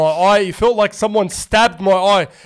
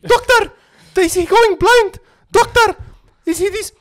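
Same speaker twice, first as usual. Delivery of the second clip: panicking mum; 13 semitones higher than usual. Retold or imitated. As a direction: imitate a frantic mum begging the doctor. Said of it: "ten times the amount she was panicking".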